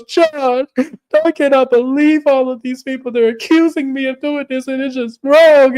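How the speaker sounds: high-pitched hammed-up wail; mock distraught call for help; Lisa. Lisa calls John about the accusations, distraught. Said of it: "And she calls John".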